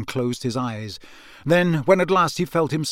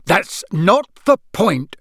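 slow, higher, louder furious father; an exasperated father losing his temper; raging; 5 semitones higher, 6 dB louder, slower than usual. A narrator's reading. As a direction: rage each word out. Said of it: "raged Mister Weasley".

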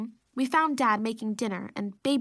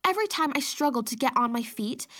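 ordinary delivery

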